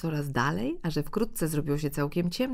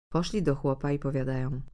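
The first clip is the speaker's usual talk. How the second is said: ordinary delivery, nothing marked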